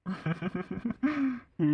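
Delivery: high, rippling giggle; laugh. Acted. Teased over wishing to remarry, a long high giggle. Amused by the wedding plan.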